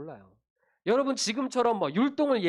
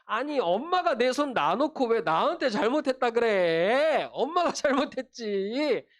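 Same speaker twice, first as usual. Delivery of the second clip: higher, mock-whining child voice; wailing child's protest; 4 semitones higher than usual. Scolded child blaming mom for letting go, mock tearful protest. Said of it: "막 이렇게 하면서 엉엉 울었겠죠".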